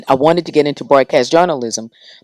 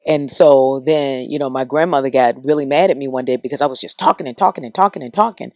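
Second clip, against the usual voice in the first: normal talking voice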